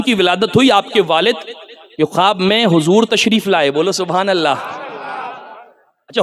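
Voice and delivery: low, soft storytelling with long pauses; hushed sermon narration. Acted. Preacher telling the dream of the saint's father, hushed. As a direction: tell it gently, phrase by phrase.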